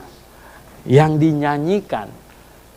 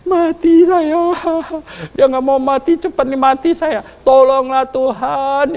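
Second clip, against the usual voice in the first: panicked plea; 9 semitones higher than usual. Retold or imitated. Hezekiah begs not to die.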